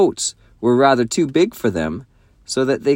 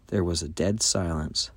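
usual speaking voice

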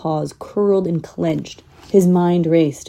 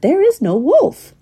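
exasperated; 12 semitones higher, 4 dB louder than usual. A narrator's reading. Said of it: "Jed muttered".

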